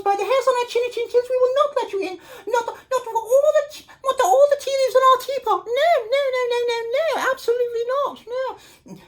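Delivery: high tiny voices saying no; three little wolves refusing the pig, high and squeaky. Acted.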